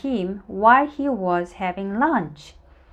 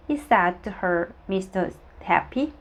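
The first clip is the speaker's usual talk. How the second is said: usual speaking voice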